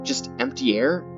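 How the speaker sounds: normal talking voice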